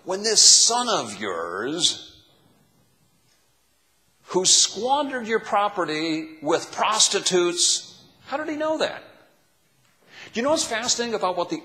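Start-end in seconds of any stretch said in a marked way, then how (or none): 0.0-8.0 slow, loud, sneering this son of yours; hissing contempt. Acted. Older brother sneering at his father about his brother. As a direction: spit out this son of yours with disdain. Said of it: "do you hear the hiss in his voice".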